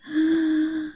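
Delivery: a high acted gasp; gasp for breath. Shown as a demonstration. Showing children breathing trouble, acted gasp. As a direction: show struggling to breathe. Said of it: "difficulty breathing".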